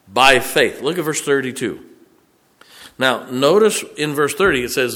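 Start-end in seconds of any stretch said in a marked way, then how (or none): 0.1-0.8 "by faith" thrown out loud as God's own word; booming by faith. Acted. Preacher voicing God to the church, "by faith" loud and strong. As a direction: proclaim "by faith" with full force. God's words restated with force.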